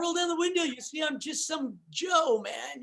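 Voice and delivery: high-voiced imitation of his own reaction. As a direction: lift the pitch, half-pleading, and stretch the end. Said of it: "And I'm like".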